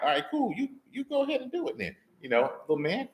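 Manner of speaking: high, quick, condescending brush-off; mocking dismissal. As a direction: talk down to the little guy, amused. Saul's camp mocking young David.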